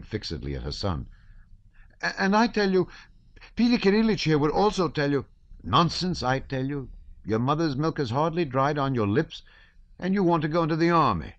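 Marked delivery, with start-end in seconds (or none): 2.0-5.2 put-on very high, loud boy's appeal; shrill pleading boy. 5.6-11.3 put-on high, exasperated old count; father rebuking his young son, high and exasperated; scolding father.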